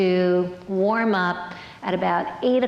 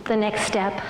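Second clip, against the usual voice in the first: ordinary delivery, nothing marked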